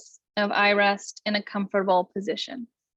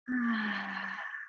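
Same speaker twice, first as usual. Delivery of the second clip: long, soft releasing sigh; sigh on the exhale; 10 dB quieter than usual. Shown as a demonstration. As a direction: breathe out with a gentle audible sigh. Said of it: "you can sigh on the exhale".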